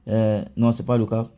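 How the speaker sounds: ordinary delivery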